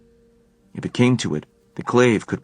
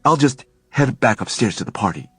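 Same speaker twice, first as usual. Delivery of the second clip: lightly voiced young man, louder, breezy; Alec, breezy; 7 semitones higher, 6 dB louder than usual. A narrator's reading. Alec trying to slip away from Helen, breezy. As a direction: brush it off and try to leave.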